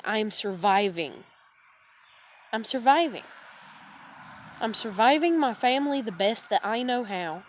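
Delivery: ordinary talk, nothing marked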